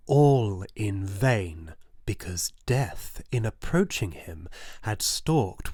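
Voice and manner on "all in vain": slow and much louder, stretched out; emphatic lament. A narrator's reading. Narrator mourning the old man's futile hopes, slow and loud. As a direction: let the words ring out, despairing.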